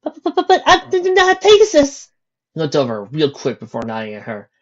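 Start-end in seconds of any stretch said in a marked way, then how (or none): 0.0-2.1 much higher, stammering in fear; terrified mare facing the captain; frightened mare. A narrator's reading. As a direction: plead in panic. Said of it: "fear overtook her".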